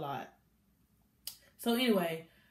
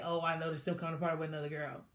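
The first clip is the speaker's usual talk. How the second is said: softer, low-key and quick, playing it cool; calm and quiet; 5 semitones lower, 7 dB quieter, much faster than usual. [name] keeping it cool with Ivy, soft quick brush-off. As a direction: brush it off so nobody makes a scene.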